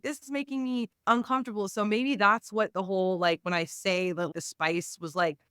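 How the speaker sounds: normal talking voice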